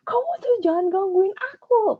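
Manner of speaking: high, loud angry outburst; angry shout. Shown as a demonstration. Teacher shows the class how anger sounds, high and loud. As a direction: snap at someone to leave you alone. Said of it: "Pasti orang kalau lagi marah".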